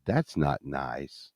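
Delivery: very low, soft and slow; private thought. Retold or imitated. Patient thinking to himself after the doctor's outburst, quietly understated. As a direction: murmur it to yourself, deadpan. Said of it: "I thought to myself".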